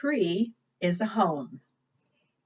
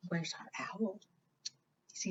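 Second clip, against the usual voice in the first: whisper; 13 dB quieter than usual. Acted. Hushed question to children.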